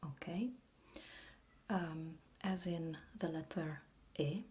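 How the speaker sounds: ordinary delivery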